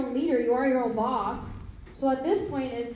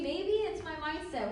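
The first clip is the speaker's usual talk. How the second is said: ordinary talk, nothing marked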